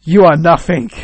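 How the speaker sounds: loud, contemptuous boss; mock-stern crushing verdict; harsh putdown. Boss dismisses the pleading engineer, loudly scornful.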